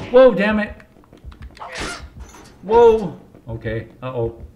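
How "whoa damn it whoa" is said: startled. Real, not acted. Hit in game.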